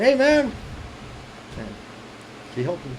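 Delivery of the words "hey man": higher, louder call to a buddy. Retold or imitated. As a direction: hail him loudly. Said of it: "I said".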